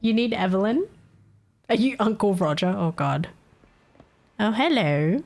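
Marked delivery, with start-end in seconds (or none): none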